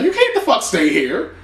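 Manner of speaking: imitating wife refusing him a room; mock Tammy. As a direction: throw shade up high. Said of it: "And she's like".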